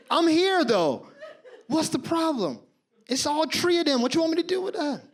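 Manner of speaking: high-pitched, indignant self-defense; acted squealing exasperation; exasperated protest. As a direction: plead your case in disbelief. Acted quarrel, defending himself to girlfriend.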